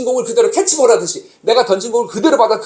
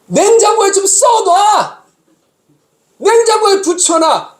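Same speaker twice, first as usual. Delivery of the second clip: exasperated shout; 10 semitones higher, much slower than usual. Retold or imitated. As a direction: bellow it at her in frustration. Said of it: "내가 항상 그 말할 때마다 뭐라고 하는지".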